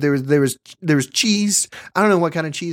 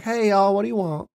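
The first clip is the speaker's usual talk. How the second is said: lightly put-on Southern accent; a hint of a Southern waitress greeting; 7 semitones higher than usual. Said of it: "she had a Southern accent".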